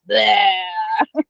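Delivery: mock eruption noise, "bleh"; blurted cry of pent-up upset, played for laughs; volcano-like eruption. Acting out her blow-up after bottling up, volcano-like eruption. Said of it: "I would just be like a volcano".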